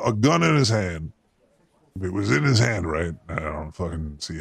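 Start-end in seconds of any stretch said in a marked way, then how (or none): none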